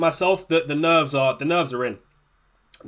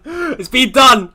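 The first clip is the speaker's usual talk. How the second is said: drawn out, higher, louder yell of relief; frantic shout; 8 semitones higher, 6 dB louder, much slower than usual. Real, not acted. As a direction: stretch it out and shout it. Frantic rush before the deadline.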